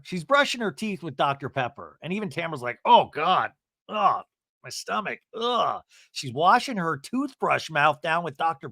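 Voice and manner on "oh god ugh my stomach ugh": imitated queasy groaning, pitched up; disgust. Tamara watching teeth brushed with soda, mock disgust. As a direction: groan as if the stomach turns. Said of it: "I say that with disgust".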